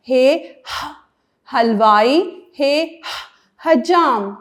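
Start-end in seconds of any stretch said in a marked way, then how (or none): 0.1-1.6 ہ stretched long as a breathy h; drawn-out h sound. Shown as a demonstration. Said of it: "ہے کی آواز کرتے وقت مجھے تو بہت مزہ آتا ہے". 2.6-3.7 ہ stretched long as a breathy h; drawn-out h sound. Shown as a demonstration. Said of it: "ہے کی آواز کرتے وقت مجھے تو بہت مزہ آتا ہے".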